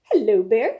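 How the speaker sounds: loud, lightly voiced owl; owl's greeting. Owl at the door greeting bear, loud. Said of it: "said Owl".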